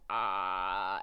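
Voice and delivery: a low quick mock deer call; deer call imitation. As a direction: imitate a deer's cry. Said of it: "and they also go".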